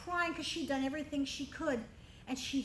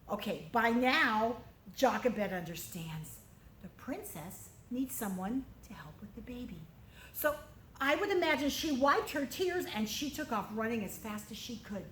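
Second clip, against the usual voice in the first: plain reading voice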